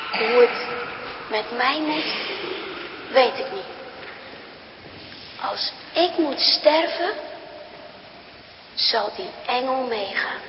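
lightly voiced soft, low mother after coughing; quiet and spent, as if out of breath; soft mother facing death; weak mother. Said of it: "gestopt is met hijgen".

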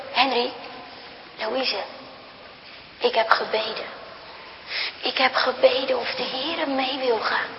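low, soft, barely audible mother; hushed words from a sick bed; faint dying mother. Acted. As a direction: speak as a mother too weak to raise her voice. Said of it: "Bijna horen ze niet wat moeder zegt".

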